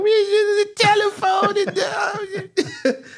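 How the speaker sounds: high, slow, laughing mock drunk voice; high laughing impression of a drunk nerd; imitating the drunk kid voice. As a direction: stretch it out like a giggling drunk teen. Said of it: "I don't even know where that voice came from".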